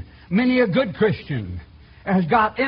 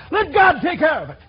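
loud, higher "Let God take care of it"; shouted; 8 semitones higher, 5 dB louder than usual. Acted. Congregant ends the shouted objection.

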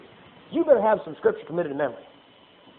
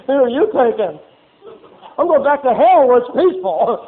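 loud, slowing, exasperated hand-off; fed-up devil; 4 semitones higher, 7 dB louder, slower than usual. Retold or imitated. The devil passing off a believer, loudly fed up. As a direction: dump him like a worn-out demon.

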